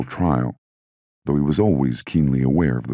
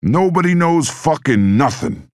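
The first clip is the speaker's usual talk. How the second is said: furious, much higher and louder, slow; furious shout; 5 dB louder than usual. A narrator's reading. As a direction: roar it out, dragging each word. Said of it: "he shouted".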